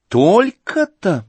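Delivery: высоко, громко и протяжно; откровенно изумляется; громкое удивление. Acted. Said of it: "громко и откровенно удивилась". (Аглая громко и откровенно удивляется сумме)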